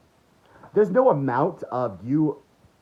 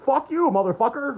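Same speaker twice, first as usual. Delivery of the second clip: mock angry outburst; 11 semitones higher than usual. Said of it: "Joe Biden's gonna get angry and say".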